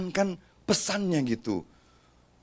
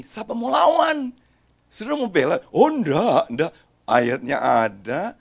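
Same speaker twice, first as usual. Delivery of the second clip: ordinary talk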